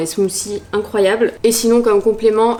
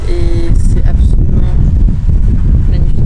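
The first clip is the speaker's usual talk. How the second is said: exaggerated rave; 12 dB louder than usual. Real, not acted. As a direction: drag it out like a rave review. Food praise, drawn out, very loud.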